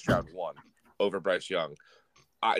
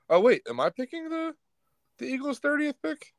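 high, startled realization; suddenly realizing, pitch jumps up; surprised. Real, not acted. Sudden realisation about pick.

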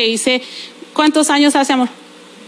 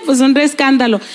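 ordinary delivery

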